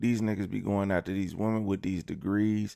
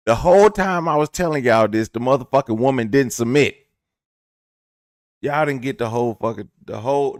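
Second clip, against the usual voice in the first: exasperated shouting; 3 semitones higher, 10 dB louder than usual. Real, not acted. Comedian telling viewers they missed it, exasperated shouting. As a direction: yell it at everyone who missed the point.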